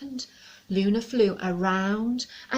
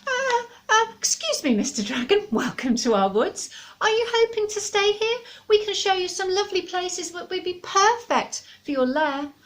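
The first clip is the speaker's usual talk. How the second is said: high, stammering little squirrel; nervous squirrel; 10 semitones higher, 4 dB louder than usual. Acted. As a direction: squeak it out timidly but politely. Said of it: "cleared his throat".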